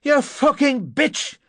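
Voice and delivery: high, slow furious yell; shouted. A narrator's reading. Narrator kicking the locked door, shouted in fury. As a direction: stretch the insult into a roar of rage. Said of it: "I shouted".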